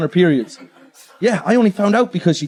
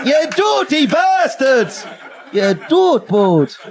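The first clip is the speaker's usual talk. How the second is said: slow, high, laughing yell; hammed-up insult yelled at the crowd; mock shout; 6 semitones higher, much slower than usual. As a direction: drag it out and yell at them.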